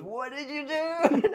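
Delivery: high, slow, laughing wail of horror; your whole body cringes as you say it; cringing self-reproach. Retold or imitated. Recalling the cringe to his co-host, a high slow wail. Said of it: "clenches up and goes".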